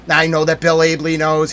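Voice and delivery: usual speaking voice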